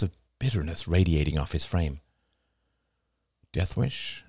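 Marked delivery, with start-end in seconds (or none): 3.5-4.2 soft murmur, lifting in pitch. A narrator's reading. Oliver murmurs a guess to Rachel, soft murmur. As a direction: murmur it quietly as a question. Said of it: "he murmured".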